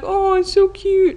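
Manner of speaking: imitating a high-pitched coo; high, gushing imitation; cooing mom. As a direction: coo over a cute pet in high mock-mom voice. Mom cooing over guinea pig, retold.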